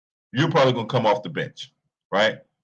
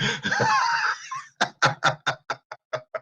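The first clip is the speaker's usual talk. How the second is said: very high squealing laughter; high-pitched laugh; 5 dB quieter than usual. Real, not acted. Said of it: "I can't stop laughing".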